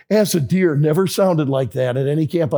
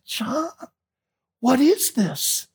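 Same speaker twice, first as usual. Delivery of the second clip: stunned question; 8 semitones higher, much slower than usual. Retold or imitated. Shocked at disfigured children.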